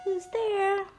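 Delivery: high sing-song who's there; playful, lilting for a small child; talking to a toddler. Acted. Ringing toy doorbell for toddler.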